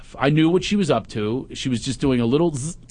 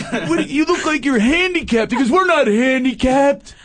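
high, loud wannabe-gangster taunt; gangster-posing teen; 13 semitones higher, 3 dB louder than usual. Retold or imitated. Son posing as a gangster, taunting his dad loudly. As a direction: swagger it like a suburban tough kid.

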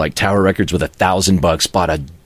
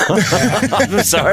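laughing apology; 12 semitones higher, much slower than usual. Real, not acted. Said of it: "do it in your radio voice".

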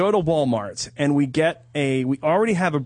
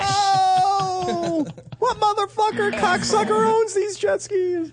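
high, hammed-up angry yell, slow; mock angry yell; much slower than usual. Acting out Ben's outraged yell at the jet ski owner. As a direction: squeal it out like a furious tourist.

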